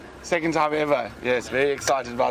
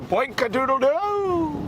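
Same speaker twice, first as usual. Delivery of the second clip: silly rooster call; 13 semitones higher, slower than usual. Pretend. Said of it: "he's gonna have to go".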